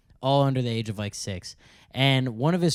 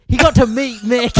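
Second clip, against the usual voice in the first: high, loud mock-British accent; badly faked British rocker voice; 9 semitones higher, 8 dB louder than usual. Son telling dad's backstage story, botched British accent. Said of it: "That's your worst one yet".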